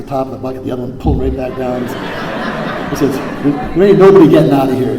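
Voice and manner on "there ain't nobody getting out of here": loud, fast mocking shout; playful hammed-up yell; mock crab voice. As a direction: bark it out like a jeering crab. Crabs in bucket, acted quote.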